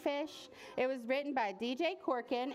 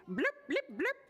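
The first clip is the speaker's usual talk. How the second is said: short high bubbly bloops; fish bloops; 10 semitones higher than usual. A narrator's reading. Smiling fish answering the boy, high bloops. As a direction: pop out high fish noises. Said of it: "The fish smiled and said".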